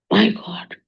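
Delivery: breathless whispered My God. Retold or imitated. The actress overwhelmed on seeing her again, whispering. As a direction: barely voice it, overcome. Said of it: "She was crying".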